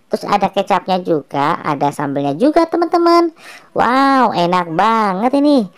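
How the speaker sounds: normal talking voice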